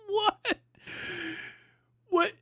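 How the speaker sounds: a high, laughing "what?" of disbelief; can't believe what he just read; stunned disbelief. Real, not acted. Streamer reacting to his brother's AI grilling, stunned laughing disbelief.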